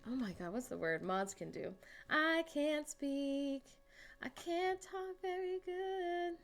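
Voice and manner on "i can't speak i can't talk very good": higher, slow, fumbling voice; mock dim-witted. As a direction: stumble slowly as if you can't talk. Jokes she cannot talk.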